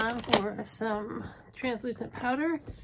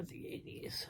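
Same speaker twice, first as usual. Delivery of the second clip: a hushed, playful whisper; whispered aside; 14 dB quieter than usual. Pretend. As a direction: let it slip like a secret. Confiding her age aside.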